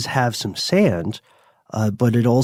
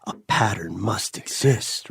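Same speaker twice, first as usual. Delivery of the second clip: whispered, fading inner voice; whispering inner voice; 6 dB quieter than usual. Acted. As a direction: whisper it like a nagging thought. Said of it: "little voice in your head is always going".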